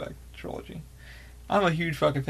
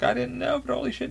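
much higher, thrilled outburst; excited disbelief; 9 semitones higher than usual. Retold or imitated. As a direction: squeal it like a fan who just found out. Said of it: "I fucking lost my shit".

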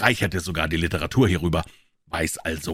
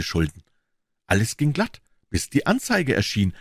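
higher-pitched eager pawnbroker; Wilson's voice; 8 semitones higher than usual. A narrator's reading. Wilson, the pawnbroker, answering Holmes, in a higher voice. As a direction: pitch him up as the earnest tradesman.

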